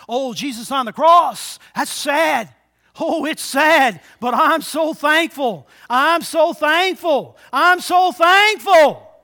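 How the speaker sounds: fervent thanks. Acted. Preacher acting out a grateful believer.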